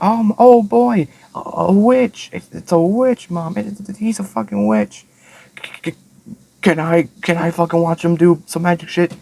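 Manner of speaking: very high breathless fangirl voice, slowed at first; hammed-up high excited squeal; mock thrilled girl. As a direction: squeal like a starstruck kid. Coco gushing over a witch.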